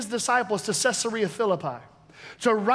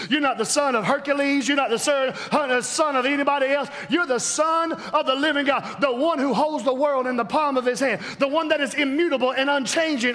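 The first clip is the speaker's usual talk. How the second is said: ordinary delivery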